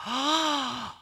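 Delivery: put-on high, slow, loud child's voice full of wonder; breathless little daughter calling to her daddy; awed little girl. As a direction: sound like a tiny girl amazed by the sky. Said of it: "you hear little Iris looking up, going".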